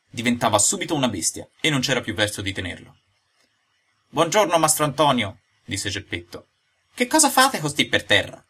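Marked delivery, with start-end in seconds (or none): 4.1-5.4 lightly voiced old man, higher and lively; a hint of a spry old character; Geppetto, lively old man. 6.9-8.4 put-on high-pitched, inquisitive old man; lively, high questioning; Geppetto, curious old man.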